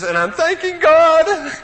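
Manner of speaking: high, drawn-out mock wail; imitated sobbing. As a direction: imitate a girl crying out through sobs. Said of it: "she's sobbing away".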